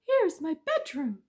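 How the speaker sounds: high, loud tricky mouse. Acted. Mouse tricking the bees, high and sly.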